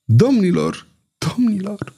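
lightly voiced plea that fades out; mild stammer. A narrator's reading. Dying duke pleading with his killers, a mild stammer. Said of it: "izbuti să mai bâiguie".

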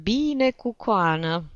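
slow, high, weary groan; exasperated, drawn-out moan. A narrator's reading. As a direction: give in with a long groan. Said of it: "gemu Chistunov".

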